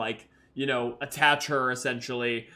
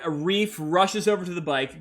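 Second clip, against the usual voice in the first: ordinary talk, nothing marked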